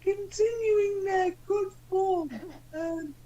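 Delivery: high warbling falsetto dame; Dame Edna. Acted. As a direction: put on a high old-lady showbiz voice. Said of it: "You're meant to be Dame Edna Everage".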